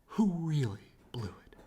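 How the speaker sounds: breathy whispered aside. Acted. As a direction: let the title end in a secret whisper. Whispered subtitle.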